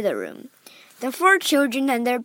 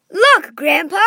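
a bright, louder call to grandpa; excited cry; 5 semitones higher, 6 dB louder than usual. Acted. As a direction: point it out eagerly, raised up. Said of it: "cried Charlie".

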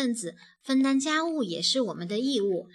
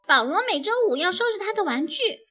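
lightly voiced girl chiming in, higher and quick; 小女孩插话; 8 semitones higher, faster than usual. A narrator's reading. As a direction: pitch it up and chime in fast. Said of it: "丽娜插了一句". (音调较高、语速较快地插话)

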